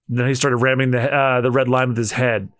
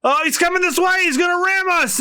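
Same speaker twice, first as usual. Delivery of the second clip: put-on high shouting girl. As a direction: scream at him to stop. Girl voice panicking.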